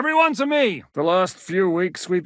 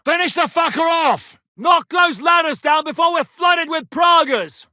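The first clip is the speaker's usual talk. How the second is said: higher and louder, fierce commands; battle shout; 7 semitones higher, 4 dB louder than usual. Acted. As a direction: roar over the fighting.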